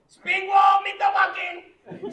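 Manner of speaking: loud, high, shouted welcome; excited waitress greeting. Retold or imitated. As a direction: call it out across the restaurant. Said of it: "and she'd go".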